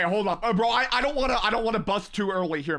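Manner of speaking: ordinary delivery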